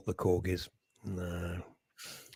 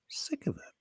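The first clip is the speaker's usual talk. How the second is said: whisper; 14 dB quieter than usual. Real, not acted.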